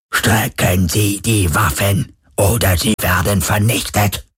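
slow, menacing machine ultimatum; electronically amplified boom. A narrator's reading. Druhun demand surrender from the ship, electronic booming voice. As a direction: drone the threat through a robot loudspeaker. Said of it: "dröhnte eine elektronisch verstärkte Stimme".

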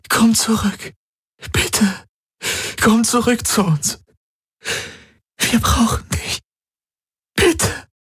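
begging. Acted. Kirai begs him back.

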